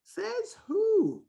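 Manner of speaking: challenging disbelief. Real, not acted. Host challenging what the world says about teens, incredulous. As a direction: dare anyone to defend it.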